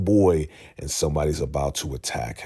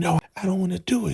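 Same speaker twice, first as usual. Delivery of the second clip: put-on high, fast frightened childlike whine; scared little boy; 13 semitones higher, much faster than usual. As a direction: whimper like a terrified little kid. Scared man acting like little boy.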